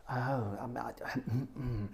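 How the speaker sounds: soft, hesitant mumbling; puzzled audience member. Retold or imitated. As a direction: imitate a baffled listener humming along doubtfully. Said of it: "the guy's sort of sitting there going".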